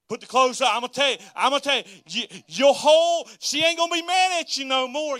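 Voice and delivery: loud, high, excited exhortation; fired-up preaching. Real, not acted. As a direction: holler the advice like a hyped-up coach. Hyped-up advice to husbands.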